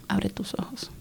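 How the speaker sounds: hushed, voiceless whisper from within; whispered command to open her eyes; soft inner voice. Retold or imitated. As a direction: breathe it like a voice inside her head. Inner voice telling her wake.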